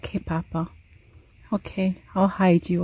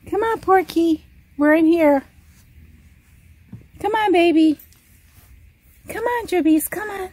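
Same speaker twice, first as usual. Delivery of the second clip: higher singsong coaxing; coaxing pet voice; 7 semitones higher than usual. Real, not acted. Owner coaxing dog into the closet, high singsong. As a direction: lure him in sweetly.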